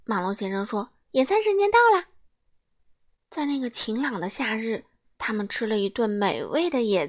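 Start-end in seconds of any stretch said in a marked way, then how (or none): 1.1-2.1 much higher, bright and loud; excited call; cheery announcement. Acted. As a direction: proudly announce lunch. Mr. Busy announces picnic.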